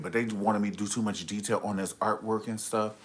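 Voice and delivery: normal talking voice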